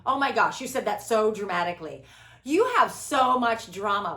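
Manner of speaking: mock someone sighing at her drama; mock exasperation. Host mimics people complaining about her drama, loud and scornful. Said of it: "I'm so intense for them".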